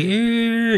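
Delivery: sharp high-pitched squeal; imitated squeak. Kid falling in gym, imitated high squeal. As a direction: squeal it up high, thin and short. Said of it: "the loudest squeak noise ever".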